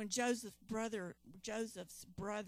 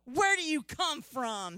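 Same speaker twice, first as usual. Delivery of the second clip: loud, higher demand of a suspicious ruler; 5 semitones higher, 10 dB louder than usual. Acted. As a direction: bark the question at the strangers, louder and higher. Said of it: "he demanded".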